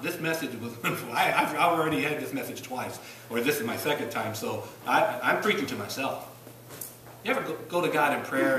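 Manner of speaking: ordinary delivery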